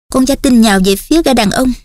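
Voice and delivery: ordinary delivery, nothing marked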